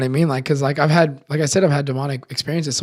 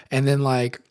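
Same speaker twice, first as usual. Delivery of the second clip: normal talking voice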